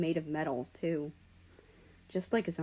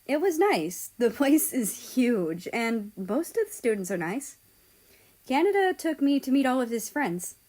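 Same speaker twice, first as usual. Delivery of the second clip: cheerful reply; 5 semitones higher, 3 dB louder than usual. Acted. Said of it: "I said".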